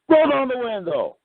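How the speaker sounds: high, barking cop command; mock cop barking an order; cop's order. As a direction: mimic the angry trooper.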